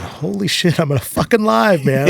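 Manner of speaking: high, loud burst of relieved joy; overjoyed relief. Retold or imitated. Guest reliving cartel boss sparing him, ecstatic shout. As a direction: shout it like you just escaped death. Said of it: "I was so fucking happy".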